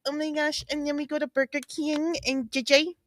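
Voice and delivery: high soft mock of the defendant's accent; Lori Vallow impression. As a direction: mimic her clipped high voice softly. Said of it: "And she's like".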